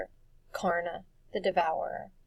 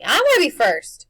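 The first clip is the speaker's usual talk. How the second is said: high, loud and eager; 7 semitones higher, 15 dB louder than usual. A narrator's reading. Boy pleading to lead into the mist, eager and loud. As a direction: insist on going first, bursting with it. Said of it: "said Wendell eagerly".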